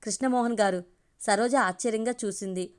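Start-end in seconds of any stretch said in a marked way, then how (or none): none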